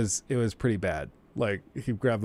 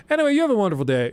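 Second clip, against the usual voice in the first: fast dismissive brush-off; 9 semitones higher, 5 dB louder, much faster than usual. Retold or imitated. Professor hangs up on angry mom.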